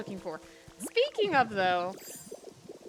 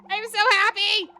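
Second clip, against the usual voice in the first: loud, higher cry of happiness; real joy; 8 semitones higher, 8 dB louder than usual. Real, not acted. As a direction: shout it out, overjoyed. Just caught Mew.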